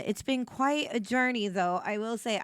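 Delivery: ordinary talk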